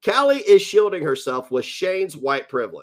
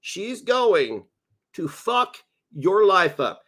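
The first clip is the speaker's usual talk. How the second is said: slow, stress on fuck; slow warning; 4 semitones higher, slower than usual. Real, not acted. Streamer warning Shane slowly and gravely. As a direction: drag out the warning. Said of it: "repeated warning".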